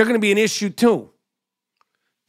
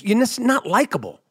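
usual speaking voice

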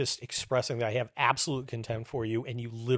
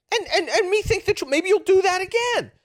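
stammering, very high and loud; squeaky, mock-reasoning indignation; exasperated retort; 5 dB louder than usual. Host tells liars he expects more lies, exasperated.

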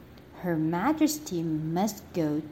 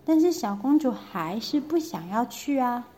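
usual speaking voice

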